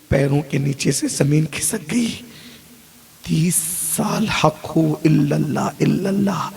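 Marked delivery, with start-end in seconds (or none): none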